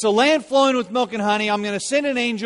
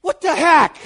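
put-on indignant kid; 8 semitones higher, 7 dB louder than usual. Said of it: "And I was like".

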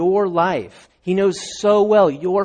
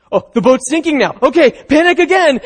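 high, loud hammed-up alarm; mock panic; 10 semitones higher, 9 dB louder than usual. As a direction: act the boat sinking under you. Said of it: "then they think".

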